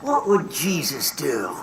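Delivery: slow, unvoiced whisper of advice; hushed slow whisper. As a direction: breathe the question out softly. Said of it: "you should think".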